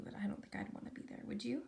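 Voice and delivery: ordinary delivery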